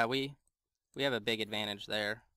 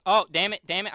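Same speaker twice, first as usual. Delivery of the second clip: frustrated; 6 semitones higher, 10 dB louder than usual. Real, not acted. Took damage midair.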